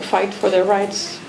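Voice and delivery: ordinary talk, nothing marked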